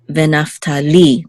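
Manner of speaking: "Venaftali" landing on a long "li"; stress on LI. Shown as a demonstration. Teacher showing where the accent is, final stress in "Venaftali". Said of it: "the last syllable".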